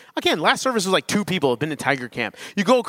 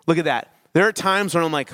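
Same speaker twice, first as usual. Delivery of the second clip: ordinary delivery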